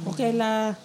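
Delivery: imitated Singlish accent. Teasing kuya's accent for the friends, imitated Singlish accent. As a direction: attempt at a Singlish "Okay la". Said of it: "hindi ko kayang gayahin yung accent".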